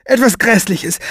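high, dramatic dread, falling at the end; alarmed statement. Acted. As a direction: pronounce the worst as certain. Woman fears the worst.

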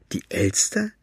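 high, shocked disbelieving question; can't believe what she heard; incredulous. Acted. Maggie repeats in disbelief. (ungläubig, hoch und fassungslos)

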